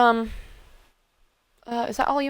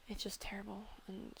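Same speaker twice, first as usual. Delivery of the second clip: much softer, barely voiced; anxious whisper; 19 dB quieter than usual. Apologizing to friend after panicking, in a whisper. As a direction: whisper the worry, nearly gone. Distraught character after emotional outburst.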